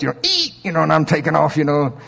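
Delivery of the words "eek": squeaky high tire chirp. Retold or imitated. Preacher imitating his tires squealing: high squeak. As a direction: mimic tires chirping as the car leaves. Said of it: "the tires chirp a little bit".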